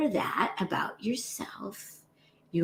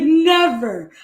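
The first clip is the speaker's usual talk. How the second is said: firm never; 7 semitones higher, 10 dB louder than usual. Retold or imitated. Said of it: "she says, she's like".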